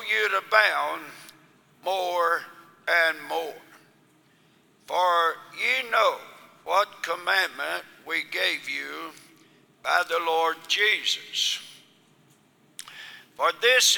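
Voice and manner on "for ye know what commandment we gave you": usual speaking voice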